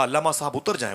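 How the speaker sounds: man running up calls out to the scholar, urgent. Acted. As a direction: call him down from the bus. Man runs up calling out.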